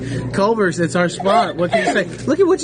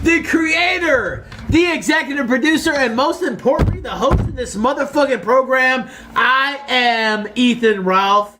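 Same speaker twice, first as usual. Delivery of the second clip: grand announcer; 6 semitones higher than usual. Acted. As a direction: announce yourself like a ring host. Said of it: "He's just announcing who he is".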